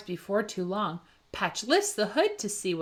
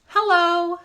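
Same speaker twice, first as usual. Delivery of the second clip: high loud horn-like hello; a tow truck honking hello; 8 semitones higher, 7 dB louder than usual. A narrator's reading. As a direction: sing it like a friendly honk. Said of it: "Joe moves on and honks".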